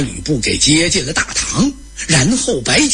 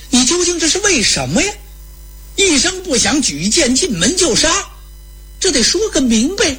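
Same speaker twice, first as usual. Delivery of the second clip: high, indignant questioning; acted protest, pitched well up; demanding explanation; 10 semitones higher than usual. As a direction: press him for an answer in a raised pitch.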